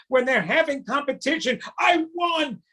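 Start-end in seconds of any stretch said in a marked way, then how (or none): 1.8-2.6 put-on high, bragging child voice; boastful kid. Kids in competition showing off, high bragging voice. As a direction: crow like a kid who just won. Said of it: "Those are what kids do".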